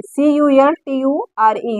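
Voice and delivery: ordinary talk, nothing marked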